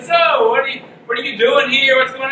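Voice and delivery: lightly voiced men quizzing a priest; curious strangers.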